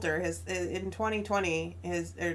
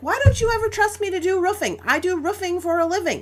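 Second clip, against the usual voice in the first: mock offended husband; 9 semitones higher, 6 dB louder than usual. Husband's retorts in retold argument.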